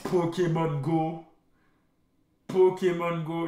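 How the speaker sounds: slow, savoring each syllable; drawn out. Real, not acted. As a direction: stretch out the name. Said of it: "repeats it drawn out".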